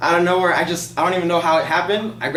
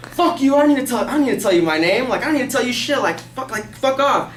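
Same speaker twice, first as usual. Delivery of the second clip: higher-pitched retold teenage defiance; defiant cussing out; 8 semitones higher than usual. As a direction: cuss him out like a rebellious skater. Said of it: "I'm gonna cuss this guy out".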